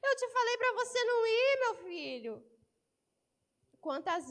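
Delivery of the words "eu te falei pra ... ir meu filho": parent reproach. Acted. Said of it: "um olhar que fala assim".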